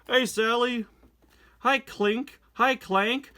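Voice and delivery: high, loud cartoon car voices; playful high-pitched car greetings; voiced comic characters. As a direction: read the comic lines in bright character voices. Reading comic characters aloud.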